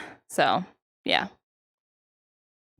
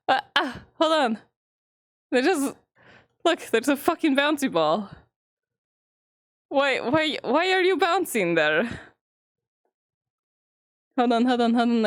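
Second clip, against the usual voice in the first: usual speaking voice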